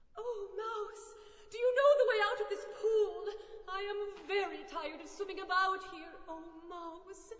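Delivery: swelling appeal that softens at the end; pleading appeal. A narrator's reading. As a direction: call out politely but pleadingly. Lost Alice appeals to mouse.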